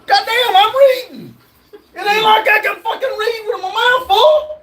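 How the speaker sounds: half-laughing rant, voice cracking up high; screaming. Real, not acted. Annoyed streamer yells at chat for mocking his eating, laughing shout. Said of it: "Why are you screaming?".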